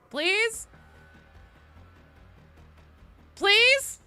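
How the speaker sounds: high, loud begging question; hammed-up wheedling plea; playful pleading. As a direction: beg with an upward lilt. Begging the developer for a class.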